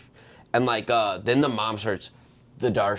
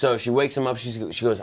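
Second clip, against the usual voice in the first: ordinary delivery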